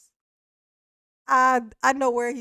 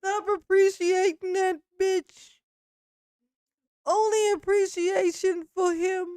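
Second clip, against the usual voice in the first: high, slow, drawn-out mocking order; mock indignant demand; 7 semitones higher, slower than usual. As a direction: stretch each word in pretend outrage. Mocking the man's imagined jealous demand.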